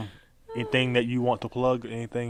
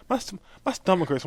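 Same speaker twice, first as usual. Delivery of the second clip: retold complaint; 7 semitones higher than usual. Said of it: "He was, he was like".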